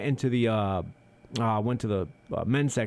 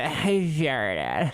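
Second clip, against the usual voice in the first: put-on rising valley girl "I farted"; casual valley girl upspeak; valley girl accent; 3 semitones higher than usual. Imitating the girl telling her friend, valley girl accent. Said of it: "Valley girl straight up".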